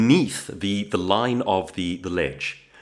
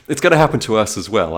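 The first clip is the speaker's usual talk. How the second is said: usual speaking voice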